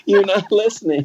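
high, amused insistence; emphatic retort. Retold or imitated. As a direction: repeat it with a grin. Repeats the retort, still amused.

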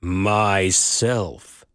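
deep and low. A narrator's reading. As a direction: say it low enough the earth shakes. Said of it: "very deep and low".

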